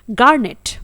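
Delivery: गार्नेट with a clear R, as garnet; American pronunciation. Shown as a demonstration. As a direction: sound the R in गार्नेट, American style. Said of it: "American accent में". (American उच्चारण, गार्नेट में आर के साथ)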